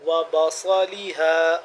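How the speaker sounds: بصلیہا with a thick, heavy ص; heavy emphatic sad. Shown as a demonstration. Teacher models the heavy ص for students, thick and full. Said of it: "ذرا موٹا کر کے".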